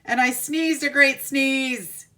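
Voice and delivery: loud, slow, building to the sneeze; big dramatic telling. Acted. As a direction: draw it out big. Wolf sneezes house down.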